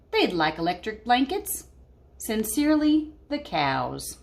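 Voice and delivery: put-on lively read-aloud voice for kids; storybook reading. Teacher reading a picture book to her class, lively.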